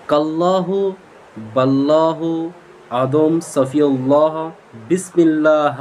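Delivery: word by word, slow and clipped apart; staccato. Shown as a demonstration. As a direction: chop each word apart slowly. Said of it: "আমি কেটে কেটে বলছি". (থেমে থেমে কেটে কেটে বলা)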